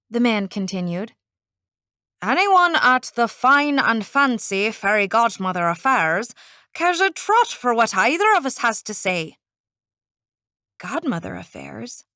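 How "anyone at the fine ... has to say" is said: small Irish man, lilting; a tiny Irishman grumbling; Irish accent. Acted. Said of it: "in an Irish accent".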